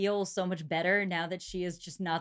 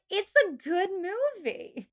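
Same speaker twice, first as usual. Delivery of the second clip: slow, high-pitched mock whine; hammed-up, drawn-out insistence; indignant defence; 11 semitones higher, 3 dB louder, slower than usual. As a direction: draw it out in exaggerated protest. Retells defending the film choice.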